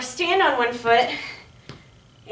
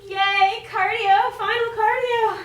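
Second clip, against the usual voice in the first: excited cheer; 8 semitones higher, slower than usual. Real, not acted. Cheering last cardio move.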